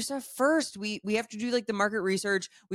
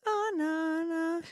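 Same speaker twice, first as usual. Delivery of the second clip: mock dismay; 9 semitones higher, slower than usual. Real, not acted. Reacting to wrong song.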